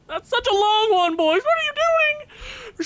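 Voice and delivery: high, strained complaint at the team; exasperated whine. Real, not acted. Exasperated at long penalty.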